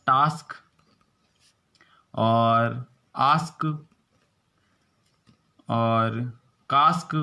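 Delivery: टास्क, आस्क, कास्क with a long "aa"; long aa vowel. Shown as a demonstration. Teacher modelling the आ sound in example words. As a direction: stretch the आ in आस्क slowly. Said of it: "आ का उच्चारण".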